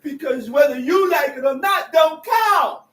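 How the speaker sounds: loud fervent preaching. Real, not acted. Preacher shouting.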